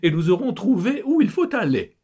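breezy, reckless driver wife; wife's voice. Acted. Wife to family about leaving, high loud voice. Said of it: "reprend Kitry".

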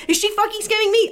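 high-pitched mock alarm; retold freaking out. Seller on the phone suspecting a scam, mock panic. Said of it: "I'm freaking out".

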